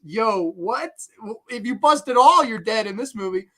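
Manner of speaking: ordinary delivery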